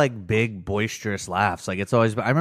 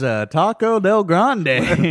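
very slow, high, drawn-out; mock teacher lecture; 8 semitones higher, 4 dB louder, much slower than usual. Teacher presenting to class, mock slow and high. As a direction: drawl it like a clueless teacher.

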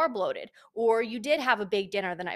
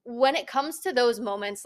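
ordinary delivery

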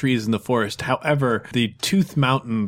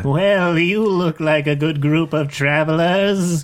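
friendly rental clerk, a bit higher; goat folk vendor; 4 semitones higher than usual. Acted. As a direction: greet the travelers like an eager salesman.

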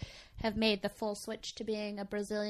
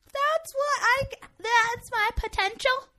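high, loud, drawn-out disbelief; hammed-up astonished question; amazed realization; 14 semitones higher, 6 dB louder, slower than usual. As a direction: squeal it in mock amazement. Acts out amazement after first wax.

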